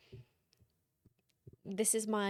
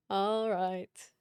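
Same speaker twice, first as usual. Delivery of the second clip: emphatic; 4 dB louder than usual. Pretend. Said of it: "two takes of all right".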